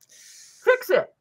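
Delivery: sharp high order; blunt command. Retold or imitated. Telling the client to fix it, sharp and high. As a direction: snap the order at him. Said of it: "Stop doing what you're doing".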